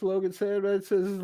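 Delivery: ordinary talk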